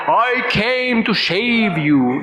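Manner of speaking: very slow Indian English, each word drawn out; Prabhupada's Indian accent. Retold or imitated. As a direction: quote the guru slowly in a light Indian accent. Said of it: "Indian pronunciation".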